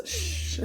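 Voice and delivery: drawn breath of a shush; hushing shh. Acted. Reader acting out the card's call to listen, hushing. Card says to hush, acted.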